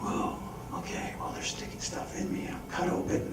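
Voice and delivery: hushed, wide-eyed whisper; whispered amazement. Retold or imitated. Patient awake during angiogram, whispering in wonder. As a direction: breathe it out in awe.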